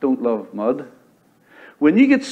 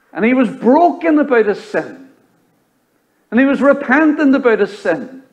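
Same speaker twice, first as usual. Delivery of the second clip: much higher, louder, pleading prayer; fervent preaching; 10 semitones higher, 4 dB louder than usual. Acted. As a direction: cry out David's plea. Quotes David's psalm fervently.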